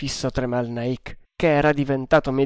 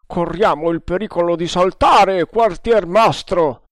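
alarmed warning; 5 semitones higher, 7 dB louder than usual. A narrator's reading. Engineer warning of explosion.

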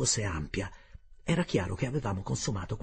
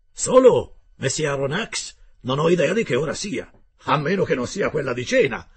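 gruff harpooner Ned; 4 semitones higher, 9 dB louder than usual. Acted. Ned Land's voice in dialogue.

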